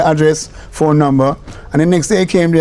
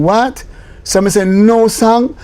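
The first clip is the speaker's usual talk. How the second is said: mock disbelief; 8 semitones higher, slower than usual. Retold exasperation.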